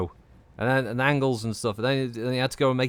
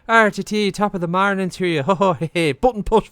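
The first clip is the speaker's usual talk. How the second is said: louder, higher stage-Irish caricature; mock Irish; 7 semitones higher, 6 dB louder than usual. Streamer denying he sounds Irish, mock Irish. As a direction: ham up a jolly Irish greeting. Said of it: "how do I sound a bit Irish?".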